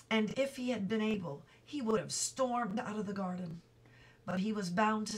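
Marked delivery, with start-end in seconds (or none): none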